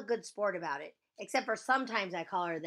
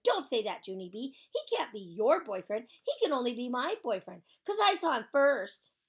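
loud, possessive protest; raised, indignant voice building to the end; angry hollering; 5 semitones higher, 8 dB louder than usual. Acted. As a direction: holler to stake your claim. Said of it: "she hollered".

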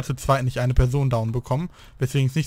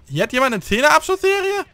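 surprised; 11 semitones higher, 4 dB louder than usual. Real, not acted. Spots an enemy streak.

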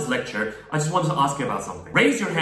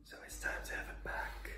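whispered, breathy threat; menacing whisper; 23 dB quieter than usual. Acted. Villain whispering a threat to the viewer. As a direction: hiss it like a villain.

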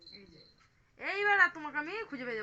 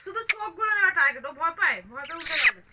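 playful excited voice; 3 semitones higher, 16 dB louder than usual. Pretend. Toy tiger game with toddler.

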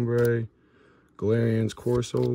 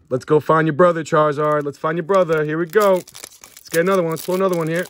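raised, punchy pep talk; eager hype; 4 semitones higher, 5 dB louder, faster than usual. Real, not acted. Card opener urging on a find for Charizard, hyped up. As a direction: psych yourself up for the next one. Psyching up for next pack.

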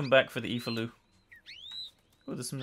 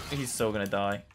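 ordinary talk, nothing marked

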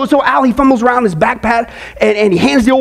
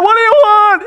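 high, terrified scream; scream of stark terror; 8 semitones higher, 3 dB louder than usual. Acted. Al rolls down the window and screams in stark terror. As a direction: scream the question in utter panic. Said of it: "he screams out in utter stark terror".

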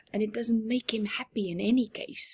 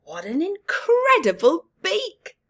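higher, louder delighted wow; amazed praise; 9 semitones higher, 6 dB louder than usual. Acted. Kat admiring Bob's beak, loud and amazed. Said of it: "said Kat".

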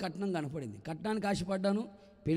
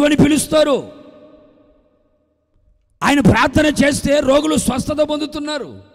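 loud, high full-force revival sermon; fervent preaching; 7 semitones higher, 18 dB louder than usual. Acted. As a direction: proclaim the healings to the crowd. (ఉత్సాహంగా, గట్టిగా బోధించడం)

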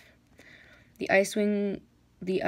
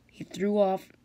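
ordinary delivery

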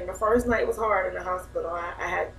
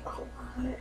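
whispered in awe, barely any voice; breathless whisper; 4 semitones higher, 12 dB quieter than usual. Retold or imitated. Seeing her baby's face.

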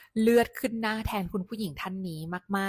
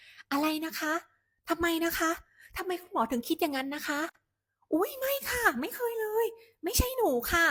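much higher, louder, making a fuss; loud protest; 11 semitones higher, 4 dB louder than usual. Shown as a demonstration. Patient loudly protesting at the doctor, much higher and louder. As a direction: raise a loud shrill fuss so everyone hears. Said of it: "ให้พูดเสียงดังๆค่ะ เน้นโวยวายไว้เลย".

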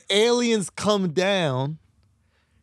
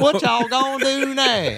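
slow, higher honky tonk drawl; hammed-up honky tonk accent; 6 semitones higher, slower than usual. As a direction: drag it out in honky tonk, laughing. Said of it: "say it in your honky tonk accent".

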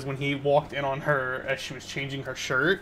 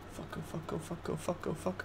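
hushed, frantic oh fuck repeated; panicked whispered cursing; 3 semitones higher, 12 dB quieter than usual. Real, not acted. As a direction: mutter curses in rising panic. Realizes bad choice in game.

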